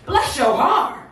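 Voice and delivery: lifted Southern "bless your heart"; down-south mama. Acted. Southern mama sweetly cursing someone, lifted down-south delivery. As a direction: call it out like a Southern mother. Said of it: "So down south, she said".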